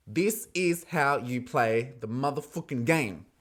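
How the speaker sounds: slow, weighty declaration; slow emphatic repeat. Real, not acted. Host repeating praise of the gameplay, slow and emphatic. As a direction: spell it out slowly, word by word.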